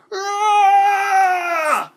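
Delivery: high, loud, long battle roar. A narrator's reading. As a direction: charge with a huge roar.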